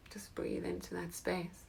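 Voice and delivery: soft guiding whisper. Acted. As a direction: breathe the words out. Meditation cue whispered.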